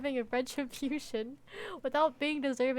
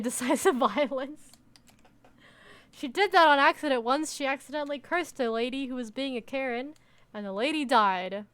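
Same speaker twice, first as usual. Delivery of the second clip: ordinary talk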